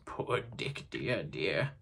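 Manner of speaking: soft, near-whispered lament; fading into grief for a lost friend; wistful sorrow. Acted. Scrooge mourning his old friend.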